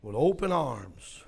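soft, low, gentle preaching; hushed, comforting close after the shouting; tender assurance. Real, not acted. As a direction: settle down and reassure them of love.

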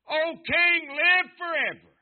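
very loud and high, triumphant call; ringing call to the king. Retold or imitated. Daniel hails the king from the lions' den, ringing loud. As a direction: belt it up from the pit.

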